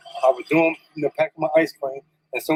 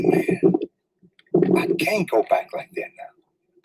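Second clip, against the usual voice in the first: hushed resolve; 3 semitones higher, much slower than usual. Retold or imitated. Father holding his baby son, hushed and slow.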